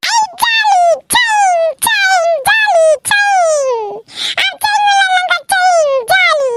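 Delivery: very high sing-song whee; gleeful squealing. Acted. Girl plays swinging game with baby, gleeful high squeals. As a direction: squeal with glee, stretching the playful sounds.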